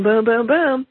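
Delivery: usual speaking voice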